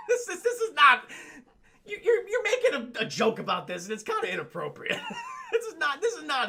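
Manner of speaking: real giggles breaking up the words; laughing while talking. Real, not acted. Streamer objecting to a tasteless joke, laughing. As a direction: try to protest through laughter. Streamer cracking up at bad scene.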